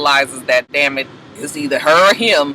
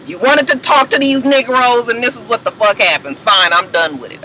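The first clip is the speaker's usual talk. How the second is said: disgusted father; 6 semitones higher than usual. Retold or imitated. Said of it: "Dad walk off like".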